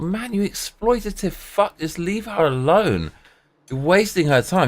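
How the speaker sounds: high indignant scolding; exasperated rant. Retold or imitated. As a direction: let the pitch fly up in disbelief at him. Inner rant at exploitative boss.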